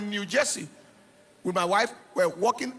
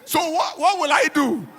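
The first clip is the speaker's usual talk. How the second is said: mock panic; 6 semitones higher, 6 dB louder than usual. Mock panic at father's order.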